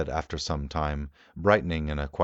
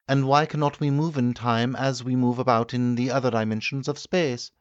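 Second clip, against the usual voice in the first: higher, louder, doubtful questioning; the doctor pressing his host with objections; the medical man objecting; 10 semitones higher, 6 dB louder than usual. Acted.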